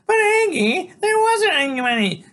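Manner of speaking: high, loud brassy woman. A narrator's reading. Loretta speaks.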